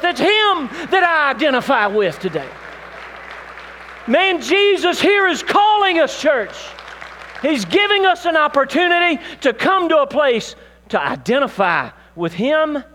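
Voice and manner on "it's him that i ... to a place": high, loud, fervent preacher voice; full-force preaching. Acted. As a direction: preach it to the whole church.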